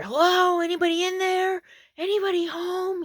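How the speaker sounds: high, loud Arkansas holler; thick Arkansas accent. Retold or imitated. Stranger calling to a parked camper, thick Arkansas accent. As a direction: call out to the house in broad Arkansas twang. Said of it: "in this thick Arkansas accent".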